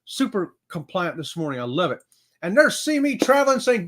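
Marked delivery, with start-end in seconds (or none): none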